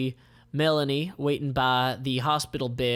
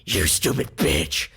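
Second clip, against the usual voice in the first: angry yell; 7 semitones lower, 5 dB louder than usual. Acted. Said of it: "he yells".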